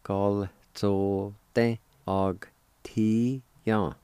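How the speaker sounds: very slow, separated syllables. Shown as a demonstration. Teacher joining both parts of janitor, syllable by syllable. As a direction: sound out the whole word one syllable at a time. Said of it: "it'll sound like this".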